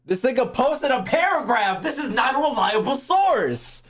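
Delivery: ordinary delivery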